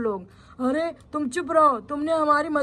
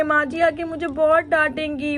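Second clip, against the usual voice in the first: normal talking voice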